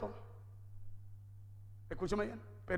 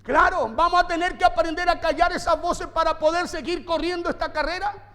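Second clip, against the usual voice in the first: loud, driving sermon delivery; forceful preaching; 6 semitones higher, 7 dB louder than usual. Acted. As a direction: preach it hard to the congregation.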